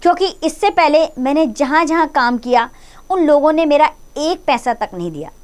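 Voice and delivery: high, small, fearful explaining; a scared maid justifying herself; frightened voice. A narrator's reading. Frightened maid explaining to her angry master, fearful. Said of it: "भयभीत स्वर में".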